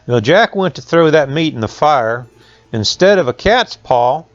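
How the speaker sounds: plain reading voice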